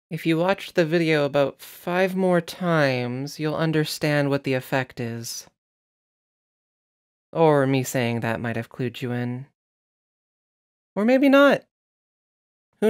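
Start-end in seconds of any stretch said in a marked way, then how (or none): none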